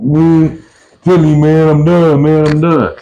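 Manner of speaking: high, loud whining plea; defeated cellmate whining; whiny quitter. Retold or imitated. Quitter pleading, voiced.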